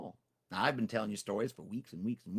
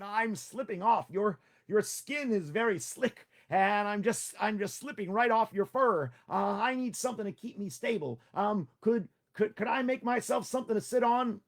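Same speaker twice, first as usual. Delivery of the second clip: Tricky the rider; 8 semitones higher than usual. Acted. Tricky asks Tiger for a saddle, higher anxious voice. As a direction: play the small nervous rider.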